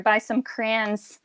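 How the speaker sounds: slow, "crayons" drawn out as "crans"; Michigan pronunciation. Shown as a demonstration. Ending the Michigan demo for a friend, "crayons" as slow "crans". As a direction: stretch "crayons" into one long "crans". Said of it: "We say it like crayon".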